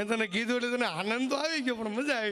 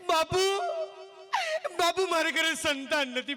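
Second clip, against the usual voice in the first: higher, whiny plea to the saint; mock wailing woman; 7 semitones higher than usual. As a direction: wail like a childless woman. (રડતી બેનનો નાટકીય અવાજ)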